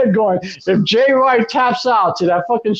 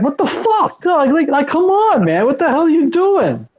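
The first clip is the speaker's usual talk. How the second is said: retold TV yelling; 5 semitones higher than usual. Retelling yelling at the TV over the match, mock exasperated yell. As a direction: complain at the screen in disbelief. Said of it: "I'm yelling at my T V like".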